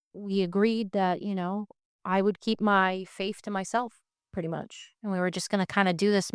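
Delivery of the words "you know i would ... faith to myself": ordinary talk, nothing marked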